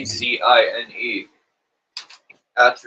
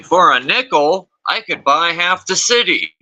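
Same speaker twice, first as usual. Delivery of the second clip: put-on higher old-timer grandpa voice; 6 semitones higher than usual. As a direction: imitate a grandpa bragging. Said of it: "That's what my grandpa used to say".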